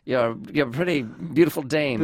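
put-on swooping, stagey old Hollywood line reading; mannered star of the old pictures, pitch swinging; stilted old-movie voice. Shown as a demonstration. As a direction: play a stilted old-time movie actor. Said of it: "stilted, like".

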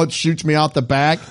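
ordinary talk, nothing marked

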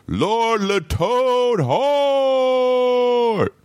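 long stretched ring-announcer call; drawn-out announcer call. Acted. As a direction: hold every syllable out high. Announces co-host, long held call.

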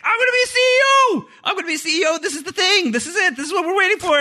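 high, loud hammed-up excited yelling; hyped. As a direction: shout it like a giddy man about to get the top job. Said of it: "he is so hyped".

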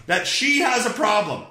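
usual speaking voice

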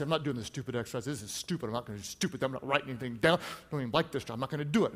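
muttered low and soft, a grumpy refusal; mock sulky congregant. Pastor mimics a listener refusing, in a low sulky mutter. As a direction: play the stubborn listener who won't write. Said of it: "you can sit back and say".

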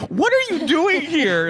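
high-pitched incredulous squawk; exaggerated startled question; imitating baffled husband. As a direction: squeal it in mock bewilderment. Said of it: "he's staring at me like".

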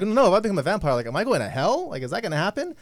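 high, loud worried inner voice; panicked inner voice. Retold or imitated. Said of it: "in my head, I was like".

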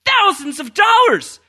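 high loud disbelief; amazed at the cost; incredulous. Real, not acted. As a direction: shout the price in disbelief. Outraged at piano price.

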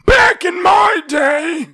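put-on high, slow, shouty old man; crotchety grandpa complaining loudly. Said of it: "talk like an old man".

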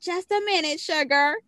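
high, syrup-thick fake Southern accent; Miss Minutes. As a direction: lay on a goofy, sugary Southern accent. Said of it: "syrup thick, like, Southern accent".

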